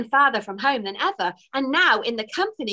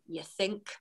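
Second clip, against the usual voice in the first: much softer doubtful murmur; 2 semitones lower, 13 dB quieter than usual. A narrator's reading. Fairy doubting the elf's optimism, soft and doubtful. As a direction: say it softly, doubting. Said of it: "replied Twigs doubtfully".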